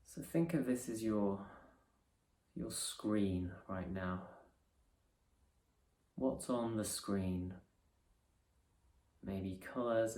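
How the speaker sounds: soft, unhurried meditation voice with long pauses; guided meditation. Acted. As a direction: ease them gently into resting awareness.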